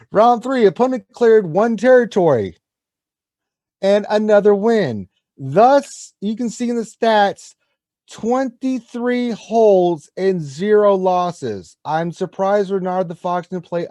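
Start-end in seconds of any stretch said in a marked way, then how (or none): 0.1-11.8 loud, high hype-man build-up; excited. Acted. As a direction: pump up the crowd over the stats. Hyping the unbeaten record.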